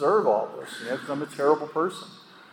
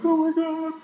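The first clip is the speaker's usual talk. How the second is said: high-pitched, hammed-up wail of alarm; put-on tearful panic, very high; mock crying mom. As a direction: imitate a distraught mother, pitched way up. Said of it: "she'd roll me over crying".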